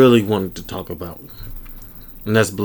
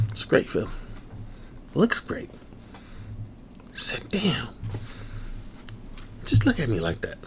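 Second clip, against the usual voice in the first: soft breathy awe; whispering; 2 semitones higher, 13 dB quieter than usual. Pretend. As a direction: hush it like a star-struck fan.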